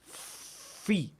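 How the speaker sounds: "fi" almost voiceless, air through the lips; breathy fi demo. Shown as a demonstration. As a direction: blow air out on "fi". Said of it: "¿Vieron cómo están comprometidos los labios?".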